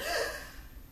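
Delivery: sharp, airy intake of breath; swooning gasp. Shown as a demonstration. Girl catching sight of him, swooning gasp. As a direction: gasp as if love-struck. Shows girl seeing her beloved.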